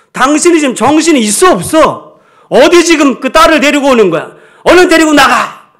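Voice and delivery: loud, high, furious outburst at the wife; angry father shouting. Retold or imitated. Sick father shouting at his wife to take the daughter out, furious yell. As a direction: bark the order to get the child out. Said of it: "이러고 소리를 친 거예요".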